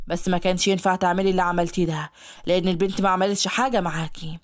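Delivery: low, gently reproachful man. Acted. Ashhab reproaches Yara, low and mild.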